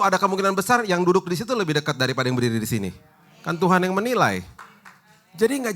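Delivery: fiery pastor pitch; full-force preaching. Acted. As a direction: drive the point home to the congregation.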